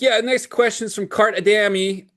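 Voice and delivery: imitating a host announcing the next question; podcast host. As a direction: project it like a show host introducing a question. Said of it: "the guy is like".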